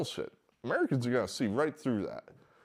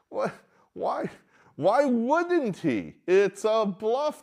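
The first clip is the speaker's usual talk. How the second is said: high, slow, louder insistence; emphatic disbelief; 12 semitones higher, 5 dB louder, slower than usual. Real, not acted. Ranting to listeners about the party bluff, high and emphatic. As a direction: hammer home it's a bluff.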